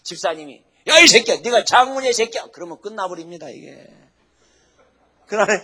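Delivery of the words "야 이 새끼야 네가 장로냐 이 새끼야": loud mock yell, higher and louder; a deacon blowing up at an elder; mock cursing. Deacon yelling insults at the elder, loud mock anger.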